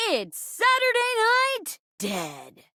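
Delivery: high, drawn-out show title; theatrical announcer. Acted. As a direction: announce it slowly, rising high, then drop on the last word. Host announces the mystery show.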